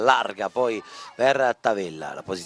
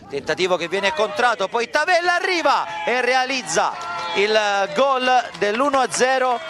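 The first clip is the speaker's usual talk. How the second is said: breathless italian goal commentary; 8 semitones higher than usual. Real, not acted. Goal scored, commentator erupts.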